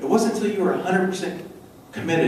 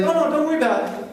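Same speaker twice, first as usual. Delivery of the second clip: high, quick, dismissive wife; high airy brush-off; 9 semitones higher, faster than usual. Retold or imitated. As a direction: wave it off lightly and fast. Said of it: "She's like".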